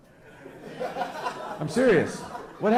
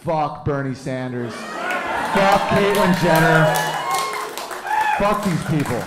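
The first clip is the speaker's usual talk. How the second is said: defiant taunt; 3 dB louder, slower than usual. Retold or imitated. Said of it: "being like".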